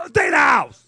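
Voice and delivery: loud mock preacher call; impression of Joel. Preacher mimicking Joel to conference crowd, loud imitation. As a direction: belt it like a TV preacher. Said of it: "it's like Joel saying".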